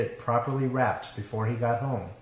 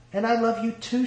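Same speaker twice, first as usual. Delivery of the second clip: high, emotional declaration; exclaiming; 11 semitones higher than usual. A narrator's reading. Bride answering her returned love, high exclamation. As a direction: let it spring out in a much higher pitch. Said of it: "she exclaimed".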